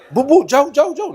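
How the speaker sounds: high, urgent shooing; high-pitched flustered shoo; shooing away. Retold or imitated. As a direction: wave them off in a comic fluster. Pushing ladies away.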